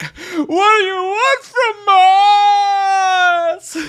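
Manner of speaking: drawn-out, high overacted wail; hammed-up scream. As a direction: scream it like a terrible actor. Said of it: "some of the worst acting".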